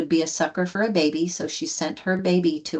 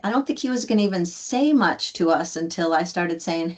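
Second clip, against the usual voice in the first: ordinary talk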